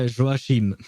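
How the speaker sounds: Joachim as zho-a-sheem, French style; French pronunciation. Shown as a demonstration. Repeating the French reading of Joachim to chat, French pronunciation. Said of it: "So it would be".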